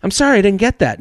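automated phone voice. Retold or imitated. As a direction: sneer the phone bot's reply. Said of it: "the shitty voice to say".

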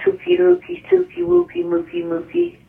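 put-on squeaky high baby talk; high cooing baby voice. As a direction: coo it like to a pet.

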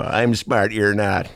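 ordinary talk

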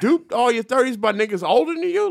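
high-pitched retold telling-off; incredulous scolding. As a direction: call her out in mock disbelief. Retells scolding a woman for being duped.

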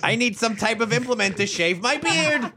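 high, hammed-up game heroine; badly faked adventurer, pitched high; Lara Croft. Host as the unlocked joke heroine, hammed-up high voice. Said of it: "unlock Chris Hardwick's voice as Lara Croft".

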